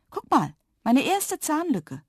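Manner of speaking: high, drawn-out excited show-off; slow, high, delighted little girl; proud child. A narrator's reading. Proud child shows tooth gap.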